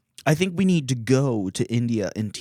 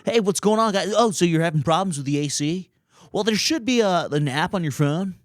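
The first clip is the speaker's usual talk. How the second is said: much higher, breezy American-style chatter; landlord's American voice; 8 semitones higher than usual. Retold or imitated. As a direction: play the chill landlord sounding fully American. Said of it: "he's super chill".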